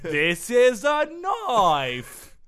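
very slow, deliberate Australian drawl; slow mock Australian. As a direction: drag it out like a movie Aussie. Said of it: "very slow and deliberate".

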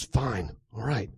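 soft, reluctant giving in; quiet, grudging surrender; relenting. A narrator's reading. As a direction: give in under your breath. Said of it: "Pex relents and says".

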